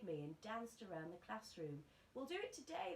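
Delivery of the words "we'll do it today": put-on high, eager boys' cry; cried out. A narrator's reading. The friends crying out to Roald, high and eager. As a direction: cheer it out high. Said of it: "they cried".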